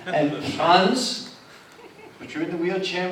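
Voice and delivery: ordinary talk, nothing marked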